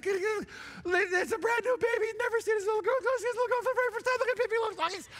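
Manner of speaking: high, fast, voice breaking; blurting it out, overcome with tears; emotional father pointing things out, voice breaking; cracking with emotion. Retold or imitated.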